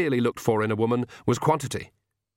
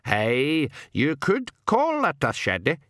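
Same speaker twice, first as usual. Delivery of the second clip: higher Scots-like pixie voice; old pixie; 4 semitones higher, slower than usual. A narrator's reading. Old pixie answers the puzzled king, Scots-like dialect voice.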